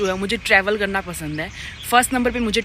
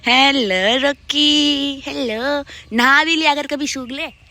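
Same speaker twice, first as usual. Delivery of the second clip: cooing at the dog; 6 semitones higher, 6 dB louder, much slower than usual. Real, not acted.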